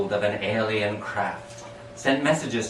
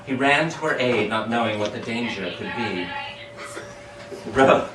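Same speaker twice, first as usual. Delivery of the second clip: ordinary delivery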